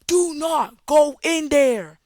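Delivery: high, drawn-out, loud warning cry; screaming at the screen. Acted. Moviegoer screaming at a film character, loud warning yell. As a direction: yell it slowly, stretching every word. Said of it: "screaming at the screen".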